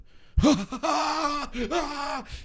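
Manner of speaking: high, hammed-up burst of laughter; stepdad's over-the-top laughing fit; imitating stepdad's laugh. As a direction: mimic the stepdad dying laughing. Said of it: "He just dies".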